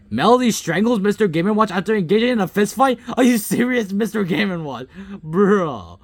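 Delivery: high, loud, rapid disbelief; incredulous outburst. Real, not acted. Gamer reading out an absurd kill, incredulous. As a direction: cry out as if it cannot be true. Outraged reaction to simulation result.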